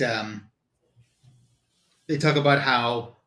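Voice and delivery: usual speaking voice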